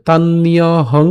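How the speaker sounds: "তান্নীয় হং" linked, slow tan-niyo-hong; joined pronunciation. Shown as a demonstration. Teacher says it together, slow linked demo. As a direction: join "তান্নীয় হং" with long held vowels. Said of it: "একসাথে".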